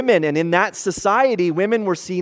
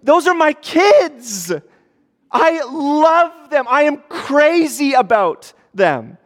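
much higher, louder and slow, gushing with love; proud dad who can't contain his love; doting father; 9 semitones higher, 6 dB louder, slower than usual. Retold or imitated. God looking at his children, higher, louder, doting. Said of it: "he goes".

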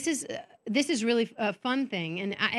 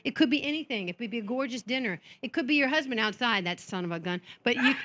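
usual speaking voice

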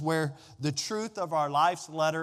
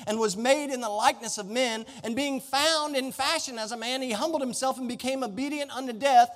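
preaching; 6 semitones higher than usual. Acted. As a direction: preach it with building fervor. Preaching at full force.

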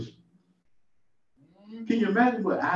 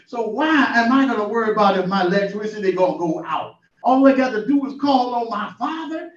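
higher, rising sermon fervor; preaching; 6 semitones higher than usual. Real, not acted. Preacher rousing his listener to trust God. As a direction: preach it with building joy.

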